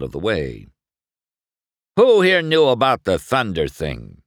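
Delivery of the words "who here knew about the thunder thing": loud, higher-pitched demand. A narrator's reading. Rival confronts his pit crew, demanding. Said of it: "he demanded".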